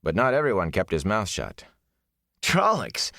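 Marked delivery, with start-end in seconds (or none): 2.4-3.1 chortling. Acted. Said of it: "Mat chortled".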